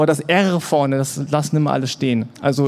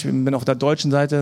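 usual speaking voice

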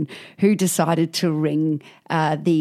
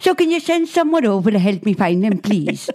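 mock Welsh old lady; 5 semitones higher than usual. Pensioner phoning about lost teeth, as a mock Welsh old lady. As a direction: play a fussy elderly Welsh caller.

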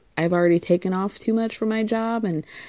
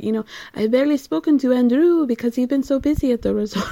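higher, quick, badly faked French accent; failed French accent; 5 semitones higher, faster than usual. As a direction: attempt a muddled French accent, rattled off quickly. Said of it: "I can't do that accent".

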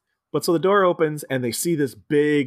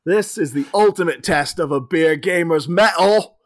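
hammed-up British sneer; 4 semitones higher than usual. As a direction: sneer it like a cocky English player. British player scoffing.

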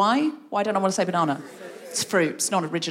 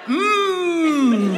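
playful hum; 6 semitones higher, 5 dB louder than usual. Shown as a demonstration. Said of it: "What if you just went".

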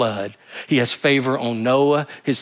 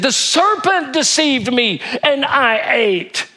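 slow, higher whiny Eve; mock Eve; 10 semitones higher, 3 dB louder, slower than usual. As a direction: play Eve passing the blame, slowly. Preacher voices Eve blaming serpent.

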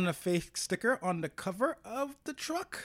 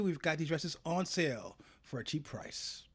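whispering; 4 semitones lower, 10 dB quieter than usual. Pretend. Mock sales pitch to fans.

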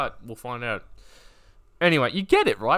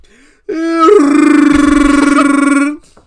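long, loud, high Wookiee roar; Chewbacca roar; 19 dB louder than usual. Acted. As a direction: let out a drawn-out Chewbacca bellow. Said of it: "He's with, of course, Chewbacca".

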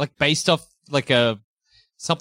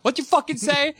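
much higher, hammed-up threat; high mock tough Peach; 10 semitones higher than usual.

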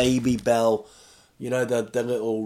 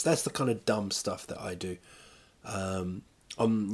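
ordinary talk, nothing marked

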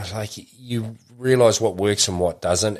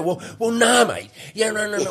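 higher, refusing mock voice; mocking someone who won't take an apology, higher voice; mock grudge-holder; 12 semitones higher than usual. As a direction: lightly play someone who won't accept sorry.

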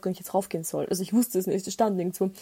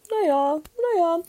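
hesitant landlord; 8 semitones higher, slower than usual. Retold or imitated. Imitating his hesitation.

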